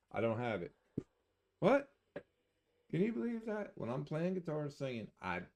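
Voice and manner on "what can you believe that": mock surprise. Mock disbelief at himself.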